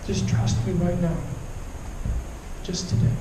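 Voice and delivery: soft, partly whispered voice of God. Acted. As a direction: hush it, gentle reassurance. God's voice answering him.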